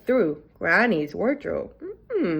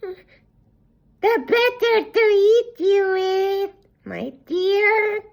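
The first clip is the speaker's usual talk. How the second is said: high, very slow, louder mock-sweet voice; wolf savouring the last line; menacing wolf; 12 semitones higher, 5 dB louder, much slower than usual. A narrator's reading. Wolf springs the trap on the girl, slow and louder.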